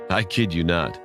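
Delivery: ordinary delivery, nothing marked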